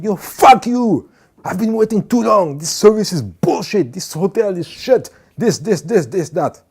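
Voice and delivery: high, loud swearing rant; rude guest. Retold or imitated. Hotel guest cursing out a worker at the desk, high and loud. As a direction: rant like an abusive customer.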